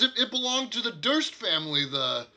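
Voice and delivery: high and loud, incredulous; startled townsman voice; surprised NPC. Acted. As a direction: make him gasp at the news. GM voices NPC about horse.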